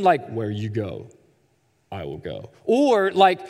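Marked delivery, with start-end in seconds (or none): 0.3-2.5 very low, hushed action-hero voice; Liam Neeson in Taken. Acted. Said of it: "a Liam Neeson voice from Taken".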